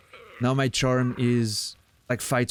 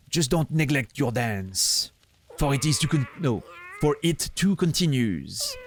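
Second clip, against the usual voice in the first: put-on French accent. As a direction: stretch it out like a French ringmaster. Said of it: "I need to work on my French accent".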